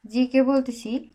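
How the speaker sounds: normal talking voice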